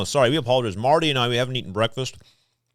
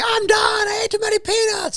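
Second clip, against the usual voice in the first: retold screaming. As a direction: scream it out high, mimicking someone panicking. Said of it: "he started screaming".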